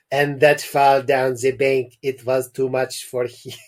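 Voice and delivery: put-on French accent drifting into Russian; lightly voiced accent slipping Russian; Russian-ish accent. Doctor warning the parents, accent slipping toward Russian. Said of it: "He's turning Russian".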